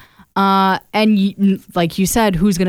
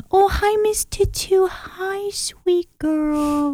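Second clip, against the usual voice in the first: put-on high, soft cooing baby talk; doting cat-dad baby talk; 11 semitones higher, 5 dB quieter, much slower than usual. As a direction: coo sweetly to the cat. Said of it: "talk about baby voice".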